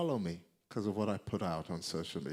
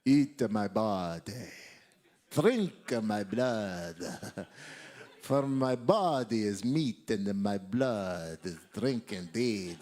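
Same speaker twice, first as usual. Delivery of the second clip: hammed-up Armenian or Romanian accent, slow and louder; mock Armenian accent; 7 dB louder, slower than usual. Preacher jokes, quoting Jesus in mock Armenian accent. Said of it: "if you say it in an Armenian or Romanian accent".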